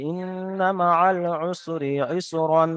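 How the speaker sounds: slow recitation ending ইসরান with the -n sound; Quranic recitation with tanween. Shown as a demonstration. Teacher shows the ending not to use at a stop, slow recitation. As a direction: draw it out and keep the nasal ইসরান. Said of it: "খুসরন কিন্তু বলবো না".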